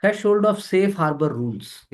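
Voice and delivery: drawn-out, very slow reading of the term; slow headline read. Shown as a demonstration. Teacher to exam students, announcing the topic very slowly. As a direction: spell the heading out for the class.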